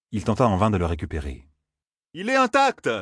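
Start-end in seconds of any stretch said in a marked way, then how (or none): 2.1-2.9 much higher triumphant call; excited call. A narrator's reading. Matt to Ingtar while running, much higher and gleeful. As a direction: toss it out gleefully. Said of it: "lança Matt".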